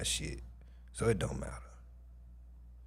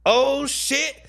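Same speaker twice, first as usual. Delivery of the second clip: high, loud burst of surprise; excited shock; 7 dB louder than usual. Real, not acted. Streamer finds a new friend request, shocked yelp. Sudden discovery on Instagram.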